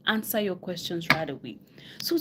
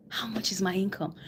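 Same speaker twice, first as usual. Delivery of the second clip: whispered; 2 semitones lower, 6 dB quieter than usual. Retold or imitated. Coach voicing a viewer quietly asking about their income, whispered. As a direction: drop to a whisper for the question.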